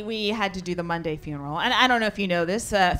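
ordinary talk, nothing marked

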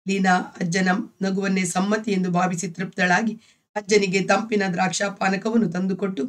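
ordinary delivery

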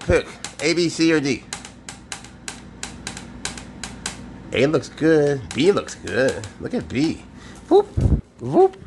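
high, sing-song voice for a pet; pet talk. Real, not acted. Keeper coaxing his pet snake Swaggers, high cooing.

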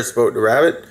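ordinary delivery

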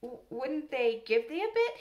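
puzzled, with a stuttered start. A narrator's reading. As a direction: stumble into a baffled question. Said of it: "he began to be puzzled".

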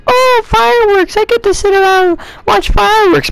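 very high, squeaky mock excitement; hammed-up falsetto glee; mock excited crowd. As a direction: imitate everyone gushing in a silly high voice. Mimics what everybody thinks on the Fourth.

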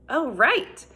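ordinary delivery

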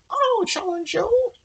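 very high, slow tiny wren voice; shrill little voice. A narrator's reading. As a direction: peep it out like a brave tiny bird. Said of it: "a shrill little voice peeped up".

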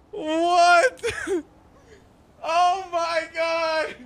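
ordinary delivery

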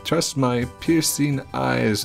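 prophesy doom in a high, dramatic voice; fortune teller voice. Acted.

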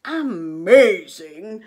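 loud, slow monster growl; a big hungry beast; Gruffalo voice. A narrator's reading. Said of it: "said the Gruffalo".

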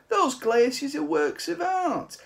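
high, loud, slow and impassioned; indignant old artist. Acted. Old man defending his glaciers to the mice, high and impassioned. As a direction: plead for the glaciers as high art.

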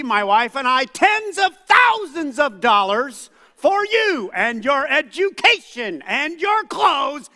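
loud, high, slow furious dad; hammed-up furious father shouting; angry parental speech. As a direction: let the dad explode at the kid. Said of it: "You wanna hear my speech?".

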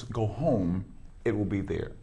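ordinary delivery

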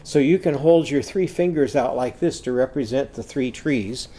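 ordinary talk

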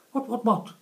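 high, loud chirpy bird voice; little bird. Acted. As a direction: chatter it like a startled tiny bird. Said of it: "says the little bird".

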